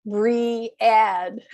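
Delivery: bread split into two syllables, be-read; learner's two-syllable bread. Shown as a demonstration. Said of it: "many people wanna pronounce it as bread".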